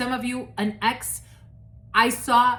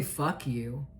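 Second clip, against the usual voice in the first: mock curse; 6 semitones lower, 8 dB quieter than usual. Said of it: "Someone wants to say".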